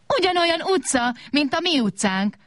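high, gleeful exclaim; high, happy table voice; delighted. A narrator's reading. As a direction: clap and cry out with joy. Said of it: "tapsolt az asztal".

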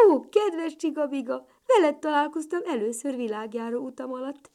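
high, loud, delighted greeting; joyful. A narrator's reading. Little ladybird greeting the snail, joyful. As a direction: greet an old friend with delight. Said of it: "örvendezett kis Katica".

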